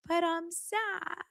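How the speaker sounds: very slow, high, soft pouty voice; mock sad whimper. Imitating Meredith's sulky reply, slow soft high pout. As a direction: whimper it slowly. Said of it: "She's like".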